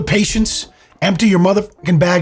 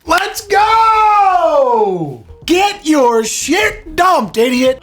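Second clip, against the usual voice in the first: triumphant shout; 13 semitones higher, much slower than usual. Real, not acted. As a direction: cheer like at a game. Cheering the owner on.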